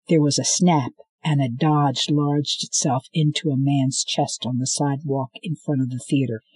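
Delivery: plain reading voice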